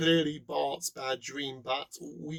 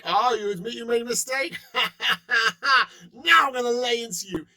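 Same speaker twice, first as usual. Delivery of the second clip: higher, louder, with a gloating laugh; gloating mock attacker; 6 semitones higher, 7 dB louder than usual. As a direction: pounce on the confession, mocking.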